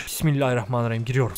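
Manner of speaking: quick quiet bismillah under the breath; muttered prayer. Real, not acted. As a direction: murmur the blessing softly before going in. Entering a house, muttered prayer.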